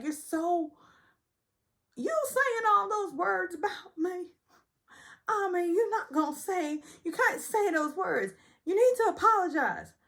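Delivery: high, aggrieved imitation; high, tearful mock demand; mock Sutton. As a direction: whine the accusation high. The quarrel voiced out.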